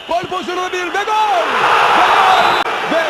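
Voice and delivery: screaming football commentator. Acted. Goal call.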